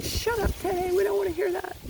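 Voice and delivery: high mocking whiny voice; high whiny mock of annoyed women telling him off; annoyed listeners. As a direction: squeal it like offended listeners. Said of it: "They're like".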